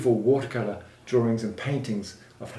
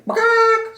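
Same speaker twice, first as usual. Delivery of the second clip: very high, loud hen squawk; hen cluck; 8 dB louder than usual. A narrator's reading. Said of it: "said the hens".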